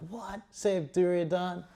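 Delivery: high-pitched mock disbelief; incredulous protest. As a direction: question the order in a squeaky-high rise. Bhima acts incredulous at the order.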